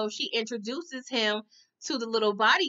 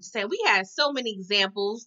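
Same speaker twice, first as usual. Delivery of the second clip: ordinary talk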